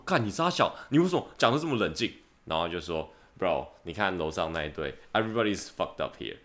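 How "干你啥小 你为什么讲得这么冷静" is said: 语气拔高、带火气地质问; 音调明显拉高，半开玩笑地冲对方发火; 气愤质问. Retold or imitated. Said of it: "我就想说". (模仿当时的自己，拔高音调愤愤质问)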